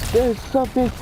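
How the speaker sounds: high, slow panicked call; high sister crying out about the stove. Retold or imitated. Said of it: "He was like".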